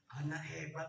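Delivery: ordinary delivery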